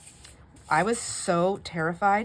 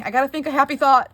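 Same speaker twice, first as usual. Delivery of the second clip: high, loud frantic reminder; mock panic, higher and louder; frantic self-talk; 9 semitones higher, 10 dB louder, faster than usual. Calming herself in retold panic.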